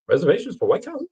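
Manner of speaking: incredulous. Retold or imitated. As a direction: sound stunned at the idea. Incredulous retold quote.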